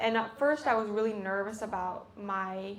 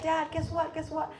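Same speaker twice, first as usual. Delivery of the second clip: excited call; 7 semitones higher than usual. Retold or imitated. Calling dad with big news.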